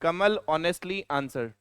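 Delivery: each word of "कमल honestly answered" drawn out very slowly; spelling it out for the class; slow dictation. Shown as a demonstration. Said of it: "सही लिखा है या गलत लिखा".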